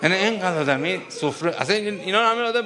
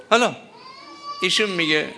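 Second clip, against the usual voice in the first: ordinary talk, nothing marked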